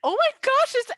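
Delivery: high, loud shriek of outrage; outraged bride. Acted. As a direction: scream at the woman crashing your wedding. Bride outraged at wedding crasher.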